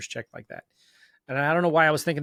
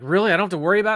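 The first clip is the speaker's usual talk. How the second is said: higher and louder, stunned disbelief; surprised disbelief; 5 semitones higher, 7 dB louder, much faster than usual. Retold or imitated. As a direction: ask it like you can't believe your luck. Disbelief at title company's reassurance.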